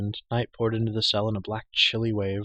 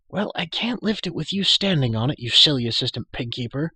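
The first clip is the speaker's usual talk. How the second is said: higher, louder exasperated grumble; complaining; 5 semitones higher, 6 dB louder than usual. A narrator's reading. Girl under the stone to the prisoner standing on it, complaining. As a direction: complain crossly at someone in your way. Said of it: "the muffled voice complained".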